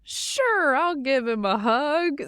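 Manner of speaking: put-on high, slow child voice; little boy. Young son humoring parents about the new lion, high child voice. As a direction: imitate a small child agreeing to please. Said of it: "he was like".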